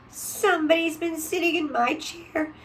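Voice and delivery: high, rising little bear voice; squeaky cub, growing upset; baby bear upset about his chair; little wee bear. A narrator's reading. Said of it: "in his little small wee voice".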